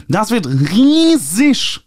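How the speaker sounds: high, slow, nasal and drawn out; nasal publisher gush. A narrator's reading. Publisher Ernie raving to the author about the book fair, high and drawn out. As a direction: stretch riesig with nasal delight. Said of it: "anstrengendes Näseln".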